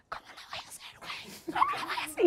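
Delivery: breathy whispered panic, breaking into a laughing squeak; imitated tearful whisper. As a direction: whisper it like a friend about to cry. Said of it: "Y empezó a llorar". (susurro lloroso imitado)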